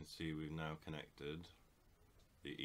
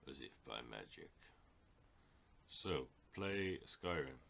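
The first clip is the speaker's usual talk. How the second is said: normal talking voice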